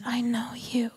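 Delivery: soft whispered reassurance. Retold or imitated. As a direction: breathe it tenderly. Bridegroom speaks tenderly.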